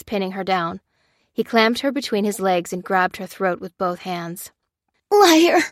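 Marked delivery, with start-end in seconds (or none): 5.1-5.6 much higher, loud strangled cry; choked scream. A narrator's reading. Lucy being strangled by Lopez, loud choked cry. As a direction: force it out while being choked. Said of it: "choked Lucy".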